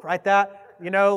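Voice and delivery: ordinary delivery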